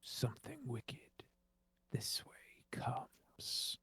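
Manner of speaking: ominous prophecy sinking into a whisper; eerie medium fading to whisper. Acted. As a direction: intone it like a seer, then whisper. Spirit medium delivers an eerie prophecy.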